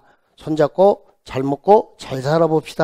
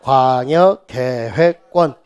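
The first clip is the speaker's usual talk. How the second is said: slow, stretched syllables; measured classroom chant; drilled term; 3 semitones lower, much slower than usual. Shown as a demonstration. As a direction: draw it out for the class to repeat. Teacher drills term for repetition.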